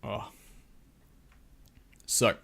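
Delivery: normal talking voice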